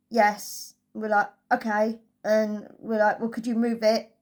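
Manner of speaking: ordinary talk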